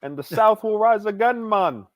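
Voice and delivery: put-on high, slow Southern accent as Joey; drawn-out Southern accent doing Joey from Friends. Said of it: "He can do a great Southern accent".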